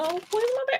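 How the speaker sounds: playfully panicked ask; high-pitched mock worry; high fretful repeat. Real, not acted. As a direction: squeak it up, worried.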